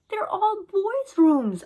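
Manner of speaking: high, loud and slow complaint; girl crying out about the boys' rooms; cried out. A narrator's reading. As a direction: wail it in disgust. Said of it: "I cried".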